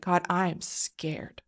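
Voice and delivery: hushed, slow and breathless; frightened whisper. Retold or imitated. Narrator reliving a scared prayer to God, whispering slowly. Praying out of fear.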